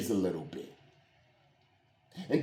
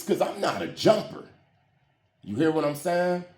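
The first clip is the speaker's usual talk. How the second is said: ordinary talk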